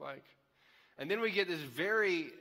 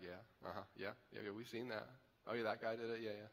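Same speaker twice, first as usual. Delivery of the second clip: much softer, lower offhand mumble; dismissive guys agreeing; 7 semitones lower, 11 dB quieter than usual. Retold or imitated. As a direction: brush it off, half-listening yeahs. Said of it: "And all the guys are like".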